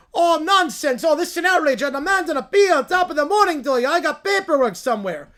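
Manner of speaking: high, loud pompous courtroom lawyer; hammy lawyer voice. Acted. To the judge, a blustering lawyer pleading his case. Acted lawyer in mock trial.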